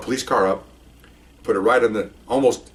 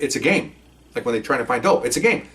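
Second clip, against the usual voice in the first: ordinary talk, nothing marked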